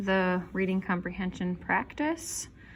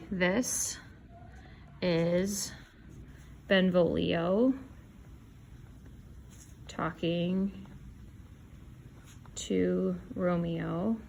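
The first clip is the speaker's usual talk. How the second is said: slow dictation; 5 dB quieter, much slower than usual. Shown as a demonstration. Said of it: "we can just start with the speaker and say".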